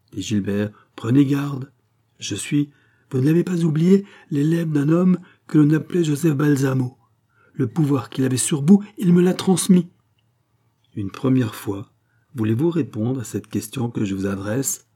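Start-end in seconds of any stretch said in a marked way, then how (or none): 1.0-9.9 higher and louder, building menace; rising threat. Acted. Gilbert threatening the countess with his mesmerist power, rising menace. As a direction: warn her with growing power.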